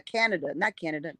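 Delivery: normal talking voice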